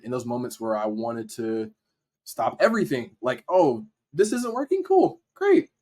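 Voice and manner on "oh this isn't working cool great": high-pitched, airy mock indifference; mock dismissiveness. Voicing his urge to stop everything, mock dismissive. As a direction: act out giving up, pitched way up.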